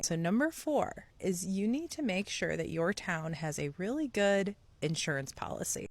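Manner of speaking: ordinary talk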